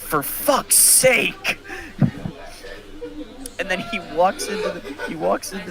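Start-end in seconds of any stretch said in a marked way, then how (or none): 0.0-1.6 drawn-out, high-pitched exasperation; exasperated. Retold or imitated. Coach texting back about the bomb scare, exasperated. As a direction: stretch out the coach's fed-up outburst.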